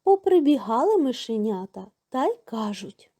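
usual speaking voice

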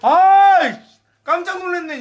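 startled shout. Real, not acted. Surprise shout then laugh.

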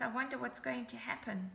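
ordinary delivery, nothing marked

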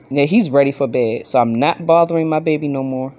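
ordinary talk

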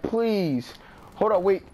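ordinary delivery

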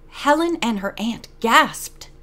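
plain reading voice

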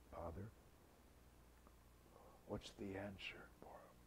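hushed confiding. Retold or imitated. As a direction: lean in and whisper it. Joseph quietly offers interpretation.

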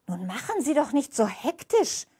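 put-on old woman's voice. A narrator's reading. Old woman scolds the nurse.

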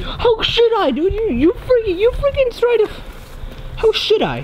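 high, spluttering protest; indignant refusal. Real, not acted. Refuses to apologise mid-quarrel, indignant.

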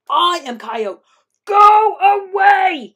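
loud boast rising to a long, high scream. A narrator's reading. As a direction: build to a drawn-out shout at the rock. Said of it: "he screamed".

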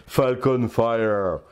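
drawn-out English-accented name. Real, not acted. Dad showing off a blaster's name, English accent. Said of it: "mon accent anglais".

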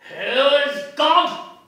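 slow, loud, high roar; furious shout. Acted. The citizen roaring at Bloom, slow furious shout. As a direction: roar the question. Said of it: "said the citizen".

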